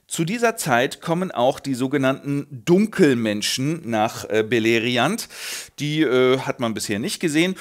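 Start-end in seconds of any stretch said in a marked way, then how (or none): none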